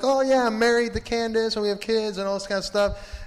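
put-on high-pitched chatty old-friend act; hammed-up reunion chirpiness; excited catching up. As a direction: play a friend proudly sharing news.

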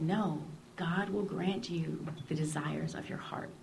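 a soft, slow, breathy whisper of gentle counsel; hushed whisper. Retold or imitated. Priest quietly counselling a doubting young woman, whispered. Priest answering her in confession.

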